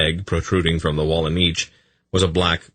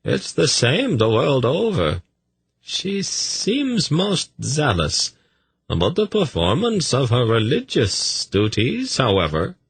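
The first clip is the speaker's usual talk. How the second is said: smooth courtier Tzadi; 9 semitones higher than usual. Acted. Tzadi remarking to the priest on a priestess, high and measured. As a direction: lift the pitch high and stretch the words.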